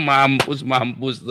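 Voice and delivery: hammed-up gloating laugh round the words; mock gloating. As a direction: laugh as if relishing an enemy's downfall. Gloating mock laugh over enemies. (tawa mengejek yang dibuat-buat)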